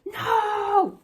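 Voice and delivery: high wailing no; dismayed cry. Real, not acted. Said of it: "Oh, I lost my spot".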